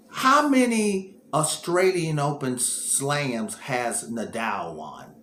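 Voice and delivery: slow, spelled-out question to a phone; slow, careful question. Real, not acted. Talking to the phone assistant, slow and deliberate. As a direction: ask it slowly so the machine understands.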